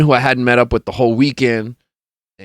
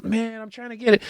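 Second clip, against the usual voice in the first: high, softer retold complaint; imitated searching friend; 12 semitones higher, 7 dB quieter than usual. Same acted searching friend.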